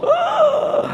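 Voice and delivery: very high drawn-out gasp of shock. Shown as a demonstration. Preacher reacting to a harsh scripture, aghast gasp. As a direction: act out being stunned. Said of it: "me quedo así como".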